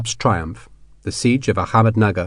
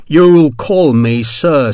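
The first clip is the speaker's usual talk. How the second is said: louder, drawn-out warning; slow, heavy threat; 3 semitones higher, 5 dB louder, much slower than usual. Acted. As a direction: stretch every word with menace. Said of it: "Sharp said".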